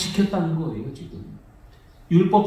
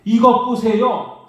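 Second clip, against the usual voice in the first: slow, loud and higher defiant retort; insolent retort; 6 semitones higher, 5 dB louder, slower than usual. Retold or imitated. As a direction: talk back to father with disdain. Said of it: "아들이 아버지한테".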